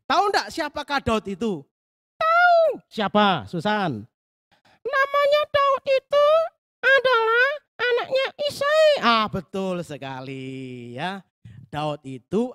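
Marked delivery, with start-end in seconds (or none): 2.2-2.8 put-on very high, loud eager child puppet voice; a curious little girl asking; Susan puppet voice. Puppet girl Susan eagerly asks the pastor for the story, very high and loud. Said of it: "ini namanya Susan". 4.8-8.9 put-on very high, loud child puppet voice answering; a proud little girl answering the quiz; Susan puppet voice. Puppet girl Susan answers the pastor's Bible question, very high and loud. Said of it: "ini namanya Susan".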